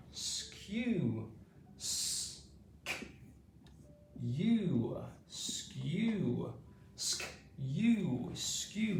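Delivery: Skew drawn out with a falling tune, S-K-U sounded apart; modelling skew. Shown as a demonstration. Teacher drilling Skew and its sounds for pupils. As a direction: sound out Skew, then split it S-K-U. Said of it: "next one is skew".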